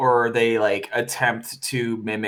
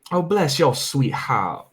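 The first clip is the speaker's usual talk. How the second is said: posh fake British; 2 semitones higher than usual. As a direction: put on a fake upper-class accent, sweetly. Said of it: "trying to be British but can't be British accent".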